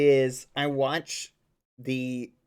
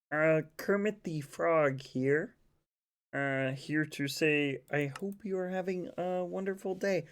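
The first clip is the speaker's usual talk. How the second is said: Kermit; 3 semitones higher than usual. Acted.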